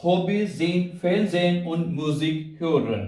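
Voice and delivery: very slow full sentence, set apart in tone; slow summary demo. Shown as a demonstration. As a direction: recite the whole sentence slowly as a model. (पूरा वाक्य बहुत धीमे से)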